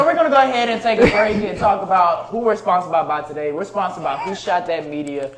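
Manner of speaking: sponsor read. Acted. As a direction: switch into ad mode for the sponsors. Host reads sponsor segment.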